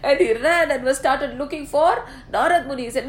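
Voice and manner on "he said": plain reading voice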